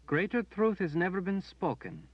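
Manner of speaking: high, firm woman's voice; a second woman affirming; high voiced other woman; another woman. A narrator's reading.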